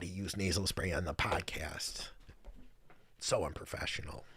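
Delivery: playful stage whisper, scandalized; mock whisper. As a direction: whisper it like gossiping about yourself. Mock scandalized aside to chat.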